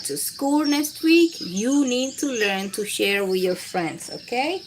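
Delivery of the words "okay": normal talking voice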